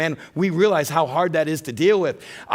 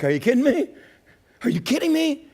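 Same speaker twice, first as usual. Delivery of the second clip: incredulous; 8 semitones higher than usual. Retold or imitated. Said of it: "they're like".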